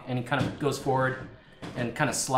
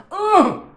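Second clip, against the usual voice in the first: put-on high, loud grunt as the boy is knocked down; kid's winded grunt; 12 dB louder than usual. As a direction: squeeze out a high grunt, flattened. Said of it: "you hear the boy go".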